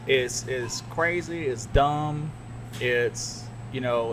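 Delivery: ordinary talk, nothing marked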